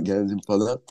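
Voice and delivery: normal talking voice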